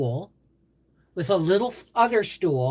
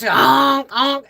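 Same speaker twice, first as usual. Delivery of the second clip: higher, loud honking goose sounds; 9 semitones higher, 9 dB louder than usual. Retold or imitated. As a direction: honk like a goose for a delighted grandson. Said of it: "And a goose".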